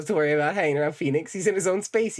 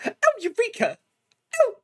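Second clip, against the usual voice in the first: high, hammed-up cry of discovery; mock eureka. Streamer mocking how Edgeworth announces a discovery. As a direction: squeal it like a prissy fop.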